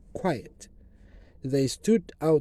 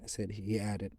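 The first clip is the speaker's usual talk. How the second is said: usual speaking voice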